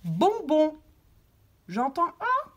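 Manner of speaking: «bonbon» said loud and high; clear model word. Shown as a demonstration. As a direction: articulate «bonbon» then answer. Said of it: "on dit juste les mots". («bonbon» articulé fort)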